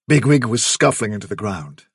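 usual speaking voice

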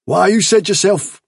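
high, loud objection; indignant protest. Acted. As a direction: throw it back at him, loudly. Bigwig protests to Hazel.

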